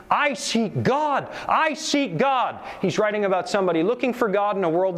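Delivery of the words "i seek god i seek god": slow, pleading cry, higher pitched; madman crying out. A narrator's reading. Madman crying in the marketplace, slow desperate plea. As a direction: drag out each I seek God like a desperate man. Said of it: "cried incessantly".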